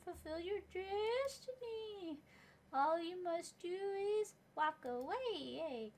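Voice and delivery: high, slow, otherworldly prophet voice; ghostly prophet. Acted. Hallucinated spirit delivering a prophecy, slow ghostly voice. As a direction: intone it like a spirit in a vision.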